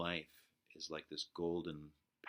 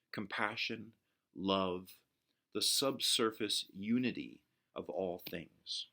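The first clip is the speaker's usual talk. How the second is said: quote read aloud; 3 semitones higher, 5 dB louder than usual. Shown as a demonstration. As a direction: lean into each word like a sermon. Reads a quoted passage aloud.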